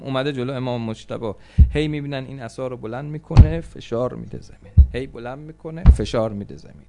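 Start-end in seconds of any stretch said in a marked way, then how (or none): none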